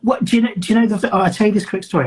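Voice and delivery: high, loud, excited protest; excited outburst. Real, not acted. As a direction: jump in with a burst of energy. Bursts out after being teased.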